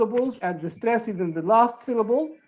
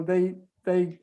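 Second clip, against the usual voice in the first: ordinary talk, nothing marked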